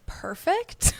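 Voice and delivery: high, mock-chipper perfect; sarcastic perfect. Mocking herself after being asked about diarrhea, high and fake-chipper. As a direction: fake brightness over bad news. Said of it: "I was like".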